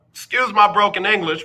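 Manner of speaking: ordinary talk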